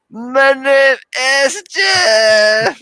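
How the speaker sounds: wild high-pitched mock scream; super crazy. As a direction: imitate an announcer going berserk, loud and drawn out. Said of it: "going so crazy. He was just like".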